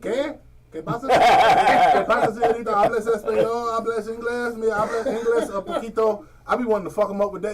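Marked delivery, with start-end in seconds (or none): 0.0-1.1 pretend Spanish speaker. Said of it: "my first instinct". 1.1-2.7 hammed-up Spanish. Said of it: "my first instinct". 2.7-6.2 pretend Spanish speaker. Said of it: "my first instinct".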